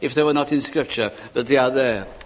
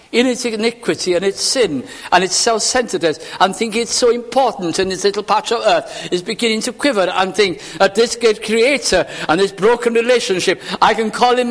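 urgent, pitched-up sermon build; fervent pulpit delivery, rising to a peak; impassioned preaching; 6 semitones higher than usual. Real, not acted. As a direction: preach it in one mounting sweep. Preaching at full force.

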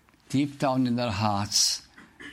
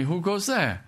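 higher sharp call from the gate; mock guard's challenge; 8 semitones higher than usual. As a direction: imitate a sentry challenging. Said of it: "somebody shouted to me".

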